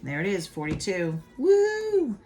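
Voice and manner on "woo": high, loud "woo"; celebratory whoop. Real, not acted. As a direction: whoop in triumph. Celebrates getting the answer right.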